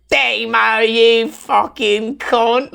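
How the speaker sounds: high, drawn-out cartoon Aussie bogan; Australian bogan parody. Podcaster imitating an animated bogan parody, hammed-up Australian accent. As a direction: curse in an exaggerated Australian accent. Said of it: "it's just so Australian".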